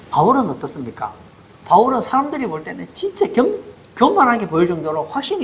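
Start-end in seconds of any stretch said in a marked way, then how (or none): none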